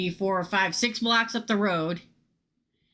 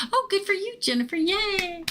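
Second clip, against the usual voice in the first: much higher, a squealed mock cheer; 12 semitones higher than usual. Congratulating herself in a viewer's voice, high mock cheer.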